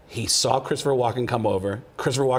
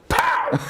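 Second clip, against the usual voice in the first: pow; 3 dB louder than usual. Retold or imitated. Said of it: "I say the word cowbell".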